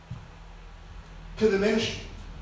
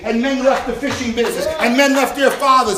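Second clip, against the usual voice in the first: loud, driving preacher's cadence; preaching at full force; 4 semitones higher, 5 dB louder than usual. Acted. Pastor stirring the congregation about faith, full-force preaching. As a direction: preach it and build to the crowd.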